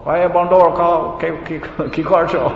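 a guru teasing a monkey, lightly acted Bengali call; mock Bengali call to monkey. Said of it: "He wasn't speaking to the monkey in Bengali".

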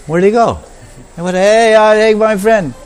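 acted high, loud call after someone. Eater whose friend suddenly disappears, calling out in alarm.

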